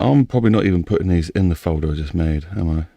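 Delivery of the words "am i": ordinary delivery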